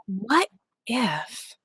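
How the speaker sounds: hushed, whispered musing; whispered thought. Retold or imitated. Narrator recalling a thought while narrating stuff, whispered. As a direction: whisper it like an idea just dawning. Retold sudden thought, voiced aloud.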